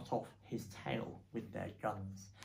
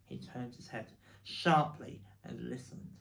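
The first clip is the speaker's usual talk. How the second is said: ordinary delivery, nothing marked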